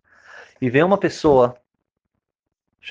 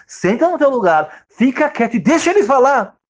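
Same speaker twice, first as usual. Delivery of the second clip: firm order; 10 semitones higher, 6 dB louder than usual. Retold or imitated. Man ordering the husband back to his seat. Telling someone to sit down.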